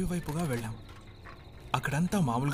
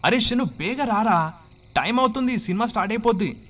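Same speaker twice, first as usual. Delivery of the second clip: high, loud hurry-up call; 10 semitones higher, 6 dB louder than usual. Retold or imitated. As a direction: yell at a friend to hurry. Friends calling him to hurry.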